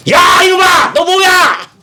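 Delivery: put-on very high, loud scolding shout at a child; parent yelling. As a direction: yell at the kid caught misbehaving. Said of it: "소리쳤을 거 아닙니까". (아이를 꾸짖는 아주 높고 큰 고함)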